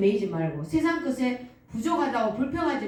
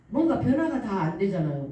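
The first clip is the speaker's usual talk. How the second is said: ordinary talk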